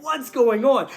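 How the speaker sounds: loud, high, bewildered yell; re-enacted startled outburst; alarmed shout. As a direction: shout it in confusion over a crowd. His panicked reaction amid shouting crowd.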